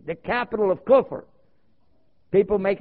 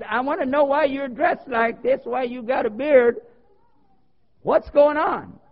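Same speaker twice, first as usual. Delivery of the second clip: higher, louder demanding elder; imitated elder lady; 7 semitones higher, 4 dB louder, slower than usual. Elder lady questions his dress and beard, imitated indignant. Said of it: "one of the elders there in the community".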